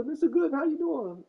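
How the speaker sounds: put-on high, chirpy kid voice; teen neighbors greeting. Neighbor kids greeting him, high chirpy voice.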